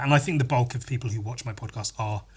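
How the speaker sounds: normal talking voice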